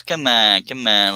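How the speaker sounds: normal talking voice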